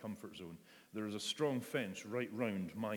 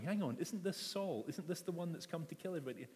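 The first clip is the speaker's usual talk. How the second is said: higher, fast disbelieving question; mock alarm at the name Saul; incredulous Ananias; 7 semitones higher than usual. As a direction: Ananias half-panicked and quick. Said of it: "there's Ananias going".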